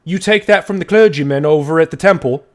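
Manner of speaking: loud, high, indignant accusing shout; angry aristocrat. Acted. Pompous dwarf aristocrat catches a thief, furious shout. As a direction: bark the accusation at the thief, outraged.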